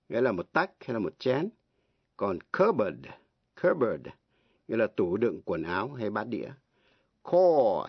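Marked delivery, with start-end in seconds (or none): 3.6-4.1 cupboard said cub-erd, no p; silent p. Shown as a demonstration. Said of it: "câm, không có đọc". 7.2-7.9 Corps said just core; silent ps. Shown as a demonstration. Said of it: "đọc là corps".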